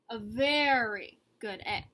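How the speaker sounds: very drawn out, proud; proud refrain, slow on very; stress. A narrator's reading. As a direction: savour the word very. Repeated refrain.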